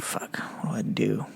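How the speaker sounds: under-the-breath whisper to himself; hushed, voiceless aside; whispered thought. Retold or imitated. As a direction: whisper it as a retold inner thought. Retold thought during the stop.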